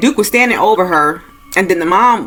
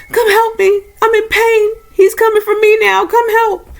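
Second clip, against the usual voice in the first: high-pitched acted cries for help; urgent retold call, pitched way up; mother's yell. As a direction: imitate a mom in pain calling from below. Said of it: "her mom was yelling from the basement".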